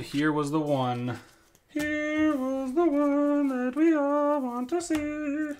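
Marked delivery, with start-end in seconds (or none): 1.7-5.5 slow, high announcer build-up; milk the big reveal; dramatic reveal. Pretend.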